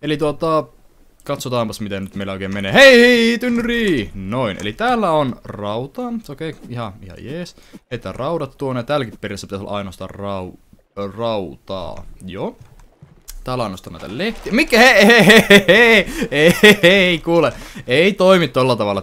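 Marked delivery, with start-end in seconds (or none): 2.7-4.1 high loud "hei, hei"; excited call. Real, not acted. Spots a barrel in game. 14.5-19.0 high loud repeated "hei"; indignant scolding. Real, not acted. Game object misbehaving.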